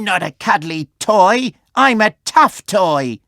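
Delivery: chest out, show off; proud toy voice. A narrator's reading. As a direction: toy standing up for his friend. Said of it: "puffed out his chest".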